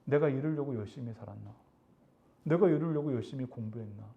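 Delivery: low, subdued voice of self-doubt; self-reproach. Acted. Wronged person doubting their own efforts, dejected self-reproach. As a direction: question yourself softly and low, as if defeated. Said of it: "자괴감이라는 걸 느껴요".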